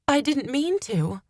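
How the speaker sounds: high-pitched desperate plea. A narrator's reading. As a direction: plead desperately with the accuser. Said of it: "I said desperately".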